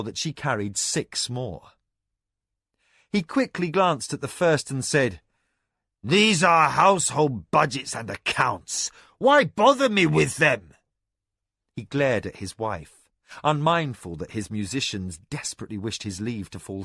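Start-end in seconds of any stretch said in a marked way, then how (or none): 6.0-10.7 loud, higher exasperated complaint; irritated outburst. Acted. Lord angrily waves off his wife's accounts. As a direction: dismiss the scrolls with scorn.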